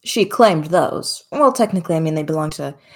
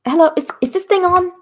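high, fast, chirpy tech-confused grandma; high excited older woman; 12 semitones higher, much faster than usual. Acted. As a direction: fumble with the mic, chattering high. God testing a radio.

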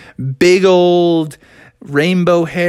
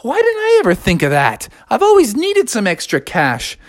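high, brightening mermaid voice; intrigued Ariel; 8 semitones higher than usual. Acted. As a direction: marvel at the idea, pitched up. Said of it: "And Ariel was like".

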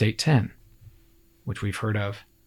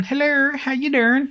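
high, sing-song cop hello; chipper high greeting; 12 semitones higher than usual. Retold or imitated. Trooper greeting the trucker, high and chirpy. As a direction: greet the driver brightly, way up high.

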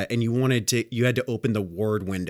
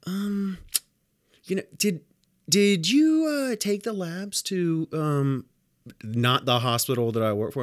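imitating the lady; 6 semitones higher than usual. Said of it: "And she was like".

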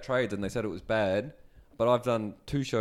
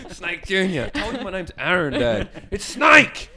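higher, rising to a loud shout on Snake; mock exasperated dad; 6 semitones higher, 5 dB louder than usual. Joking about Snake yelling at his son, mock exasperated shout. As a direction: scold the kid, building to the name.

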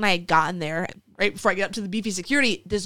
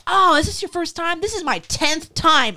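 higher, animated imitation of another contestant; chatty fellow contestant; 8 semitones higher than usual. Girl in line to the newcomer, chatty and high. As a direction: voice the seasoned contestant, pitched up.

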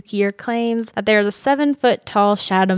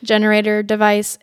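usual speaking voice

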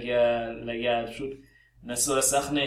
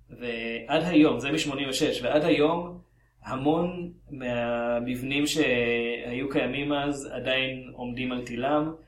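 ordinary delivery

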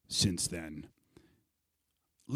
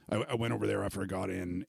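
normal talking voice

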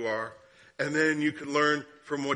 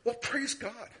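cheery praise; 10 semitones higher, 3 dB quieter, slower than usual. Retold or imitated. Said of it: "And I immediately went".

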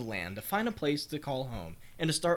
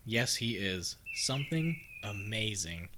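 ordinary talk

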